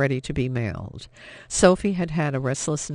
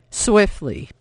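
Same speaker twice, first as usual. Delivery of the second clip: cold menace; 5 semitones higher, 6 dB louder than usual. A narrator's reading. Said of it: "never heard him speak in such a way".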